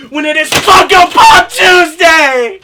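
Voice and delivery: loud, high showman sign-off; catchphrase shout. Acted. Host announcing his show day, excited catchphrase. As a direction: sell it like a show opener.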